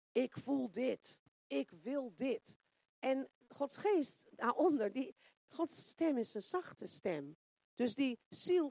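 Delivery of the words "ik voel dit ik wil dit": normal talking voice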